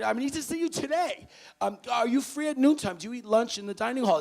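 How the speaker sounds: high, loud and pushy; insistent phone summons. Retold or imitated. Staffer summoning a freshman by phone, loud and insistent.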